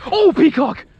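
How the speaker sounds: high, loud burst of delight; excited shout. Real, not acted. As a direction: yell it out as the fish hits. Fish strikes, calling out.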